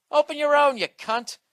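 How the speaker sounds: loud, high, indignant retort; mock angry refusal. Beer drinker refusing to share, loud acted anger. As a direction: shout down a moocher in mock outrage.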